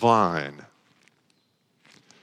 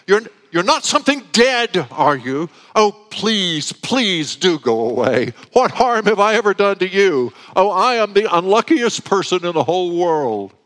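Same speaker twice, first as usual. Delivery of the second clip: high, loud, frightened pleading; almost a scream; 8 semitones higher, 5 dB louder than usual. A narrator's reading. Frightened boy pleads with the unseen thing, almost screaming. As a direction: beg the thing to go away, nearly screaming. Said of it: "he said almost in a scream".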